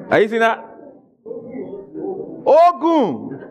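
Ogun said over and over with a different Yoruba tone each time. Shown as a demonstration. Teacher showing the congregation Yoruba tones, Ogun with shifting pitch. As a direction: show the Yoruba tones of Ogun one after another. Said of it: "one word can have different meanings based on the intonation".

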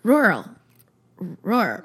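"rural" squashed into one slurred syllable; retry "rural", losing the middle sound; struggling pronunciation. Real, not acted. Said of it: "Why can't I say it now?".

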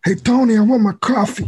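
high, hammed-up New York mafioso; New York accent. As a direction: call out like a cartoon New York gangster. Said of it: "British people do American, like, New York accents".

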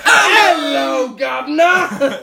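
high, loud, drawn-out mock British greeting; hammed-up British accent. Giving in to friends egging him on, hammed-up British accent. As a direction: shout a slow, silly British hello. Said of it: "Of course he said governor".

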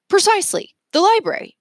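delighted inventor. Acted. Mergenser to Leo, high and thrilled. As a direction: ring out the answer gleefully.

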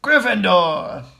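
drawn-out announcing cry; shouted. A narrator's reading. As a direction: shout it out, stretching the word. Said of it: "When it finally shouted".

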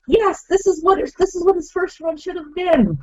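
high, thrilled inner cheer; excited. Retold or imitated. Fan cheering the comeback, high and thrilled. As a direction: gush like a fan finally satisfied.

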